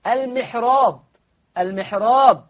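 "المحراب" mit hellem, dünnem Ra, laut; "المحراب" Ra nicht schwer aussprechen; dünnes Ra. Shown as a demonstration. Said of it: "الراء مرققة لورش".

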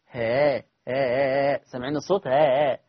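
ها softened between hamza and alif; eased hamza. Shown as a demonstration. Teacher demonstrates the softened hamza sound. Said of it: "لا قلت آ ولا قلت ها".

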